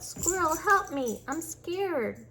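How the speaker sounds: high, frightened cry for help; scared yell. A narrator's reading. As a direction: call out for help from a shadow. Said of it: "Rabbit yelled".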